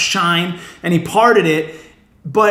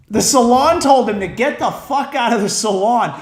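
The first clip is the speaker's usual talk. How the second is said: normal talking voice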